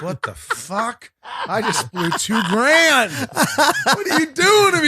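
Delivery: laughing, high, loud fake fury; mock outrage. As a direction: howl it in laughing disbelief. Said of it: "So I'm like".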